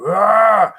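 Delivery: imitated yell. Imitating the ghost's last scream, sudden yell. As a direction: shout out the ghost's final scream. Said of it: "after that last, like".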